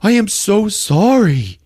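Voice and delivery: drawn-out hammed-up shocked sorry; stunned Annie. Annie voiced, stunned sympathy.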